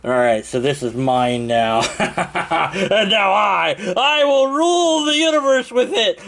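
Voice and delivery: rising, laughing villain boast; megalomaniac rant. Acted. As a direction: gloat like a cartoon villain.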